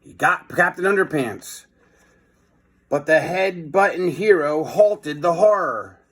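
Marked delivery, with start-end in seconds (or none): none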